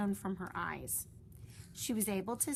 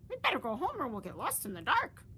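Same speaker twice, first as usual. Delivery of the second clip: high, fast, louder fretting; high quick worried chirp; worried bird; 6 semitones higher, 5 dB louder, faster than usual. Acted. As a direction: hurry them home, anxious. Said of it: "said Flap".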